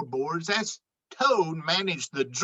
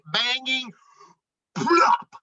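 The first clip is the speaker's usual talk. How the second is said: ordinary delivery, nothing marked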